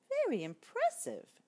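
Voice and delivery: very high admiring mouse; exclaimed. Acted. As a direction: exclaim it brightly. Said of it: "exclaimed the mouse".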